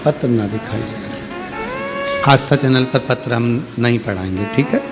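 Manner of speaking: ordinary talk, nothing marked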